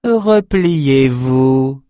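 drawn-out, very slow cold order; slow sinister command. A narrator's reading. Sorceress twin commands the captives to fold, sinister. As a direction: stretch each syllable like a spell. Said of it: "ordonna Rouva".

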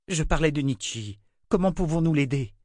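insist, worried; urgent. Acted. Richard insists on Nietzsche.

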